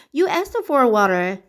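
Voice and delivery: plain reading voice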